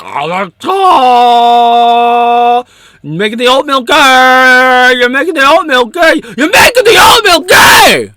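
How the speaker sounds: wailing high-pitched shriek, close to crying; screaming hysterical critic. Retold or imitated. Imagined critic crying out at his long videos, shrieking.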